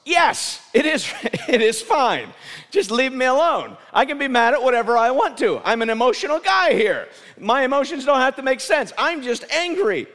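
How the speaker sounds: high, loud petulant rant; angry outburst. Acted. Jonah snapping back at God, a high loud angry rant. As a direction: rant like a sulking child. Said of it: "Jonah with an emotional response".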